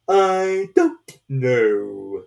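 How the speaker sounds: very slow, loud, strange drawn-out voice; slow caterpillar drawl. A narrator's reading. Caterpillar lazily questioning Alice, very slow and loud.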